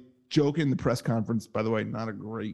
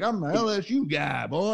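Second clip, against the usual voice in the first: a light put-on Southern accent, mocking the coach; fake Southern accent; 5 semitones higher than usual. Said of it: "his fake Southern accent".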